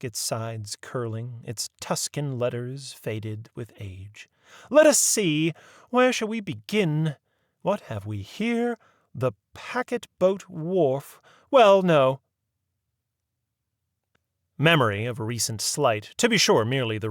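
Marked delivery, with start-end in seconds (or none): 4.7-12.2 higher, fussy genteel lady; a proper old lady leading the inquiry; prim lady voice. A narrator's reading. Mary Mabel studies the map.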